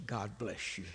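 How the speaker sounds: low, very soft, unruffled blessing; calm wife. Retold or imitated. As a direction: imitate a wife who stays perfectly calm. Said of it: "She just is the calmest person".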